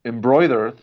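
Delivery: ordinary talk, nothing marked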